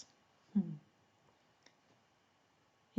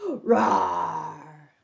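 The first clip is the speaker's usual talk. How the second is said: low, drawn-out play roar; lion roar; 4 semitones lower than usual. Pretend. Storyteller plays the lion facing the mouse, low roar.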